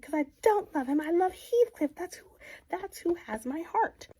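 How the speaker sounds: high and loud cry of love; passionate confession. Retold or imitated. Kathy confessing to Nellie she loves Heathcliff, high and loud. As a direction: pour out her heart, loud.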